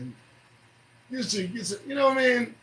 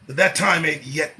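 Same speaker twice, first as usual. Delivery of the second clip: ordinary delivery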